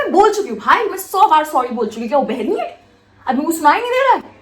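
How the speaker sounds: retelling an indignant protest at full tilt; exasperated retort. Retold or imitated. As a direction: snap back with punched, loud key words. Acted retort to being told to apologize.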